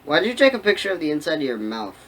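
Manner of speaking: ordinary delivery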